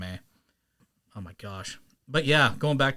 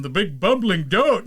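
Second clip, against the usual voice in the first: bumbling henchman; 6 semitones higher, 5 dB louder than usual. Acted.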